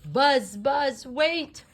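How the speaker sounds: loud high call after a friend; shouting across the room to stop him. A narrator's reading. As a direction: call out to Buzz as he leaves. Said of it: "Woody called out".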